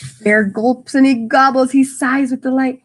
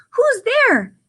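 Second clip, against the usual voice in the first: squeaky mouse voice, much higher; mouse crying out; 12 semitones higher than usual. Acted. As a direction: call out like a startled little mouse. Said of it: "Mouse cries".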